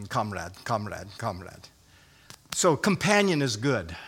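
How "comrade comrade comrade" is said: lightly voiced Russian, low and soft; low comic-book Russian aside; mock Russian. Preacher imitating comic-book Russians, low mock Russian accent. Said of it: "speaking of the Russians".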